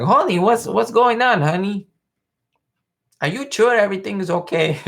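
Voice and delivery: put-on high, loud Cuban-accented Ricky; Desi's Cuban accent. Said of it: "His accent, like, makes the show".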